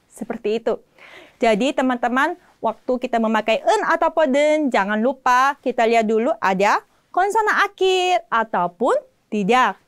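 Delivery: normal talking voice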